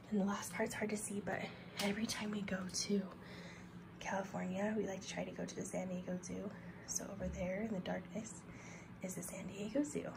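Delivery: very soft, half-whispered narration; hushed, near whisper. Real, not acted. Parent showing the dark zoo corner while they're sleeping, hushed. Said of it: "I have to be quiet".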